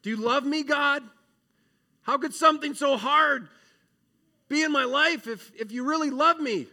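high, loud anguished crying out to God; a hurting child pleading loudly with God; desperate plea. Acted. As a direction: beg God like you're hurting.

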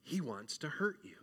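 sinister whisper of an inner doubt. Acted. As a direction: hiss it like a voice in your head. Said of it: "our doubt inside says".

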